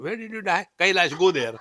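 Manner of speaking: hammed-up brusque questioning and order; mock dismissal. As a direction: send them off loudly, for comic effect. Acted gatekeeper sends soul away.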